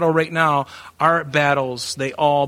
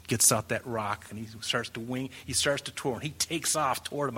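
hushed awe; 4 semitones lower, 12 dB quieter than usual. A narrator's reading. Dramatic hushed narration.

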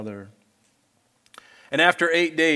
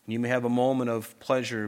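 usual speaking voice

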